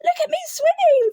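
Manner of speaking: high, loud little squeak; squeaky Roo. A narrator's reading. Little Roo shows off his swimming, proud squeak. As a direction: squeal it with pride like a tiny joey. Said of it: "squeaked Roo".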